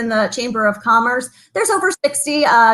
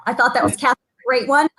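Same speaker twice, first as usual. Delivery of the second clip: ordinary talk, nothing marked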